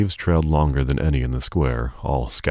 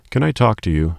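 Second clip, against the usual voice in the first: higher, louder call across a noisy shop; yelled; 8 semitones higher, 5 dB louder than usual. A narrator's reading. Sophie calling over the noisy crowd, yelling. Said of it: "Sophie yelled".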